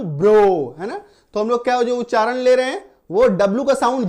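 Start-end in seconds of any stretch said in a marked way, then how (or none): 0.0-0.8 ब्रो said short and firm, ending in a plain o sound; model pronunciation. Shown as a demonstration. Teacher showing students how ब्रो sounds, firm. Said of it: "तो सिर्फ बीआरओडब्ल्यू".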